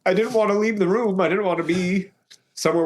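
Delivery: high, choked-up telling; real tearful voice. Real, not acted. Teller remembers crying alone in the corner, tearful. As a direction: fight back tears, voice pushed high.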